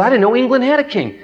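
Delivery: high, acted-out boyish wonder; amazed. Boy to pastor about England's king, surprised. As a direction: voice a child's surprise at the news.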